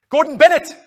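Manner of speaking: sudden high shout of alarm; crying out. Acted. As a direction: yell it in shock. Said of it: "Ace cried out".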